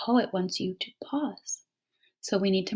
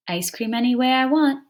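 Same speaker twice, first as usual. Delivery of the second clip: poem reading; 4 semitones higher, 4 dB louder than usual. Shown as a demonstration. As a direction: read out, a touch higher and louder. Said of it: "Now let's read the poem".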